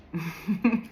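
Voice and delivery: short rising giggle; a burst of real laughter that catches her off guard; bubbly rising laugh. Real, not acted. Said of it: "I don't know why I'm laughing".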